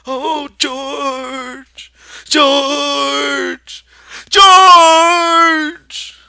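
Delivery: rising from loud to a full scream of George; building cry. Acted. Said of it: "And he cried".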